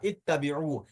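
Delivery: इत्तबिऊ opening on "it" with a doubled "t"; initial short i. Shown as a demonstration. Teacher gives the correct form, इत्तबिऊ with initial "i". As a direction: say इत्तबिऊ with "i" first, long "u" last. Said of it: "यानी जेर ही आएगा".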